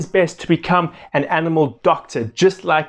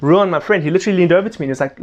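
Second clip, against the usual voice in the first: usual speaking voice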